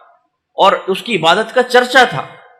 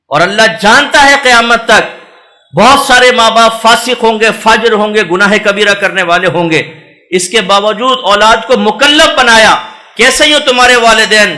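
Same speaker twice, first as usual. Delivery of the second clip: loud, high sermon build; rising sermon warning about sinful parents; fiery preaching; 4 semitones higher, 5 dB louder than usual. Acted. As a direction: preach it hard to the congregation. Preacher rising to full force.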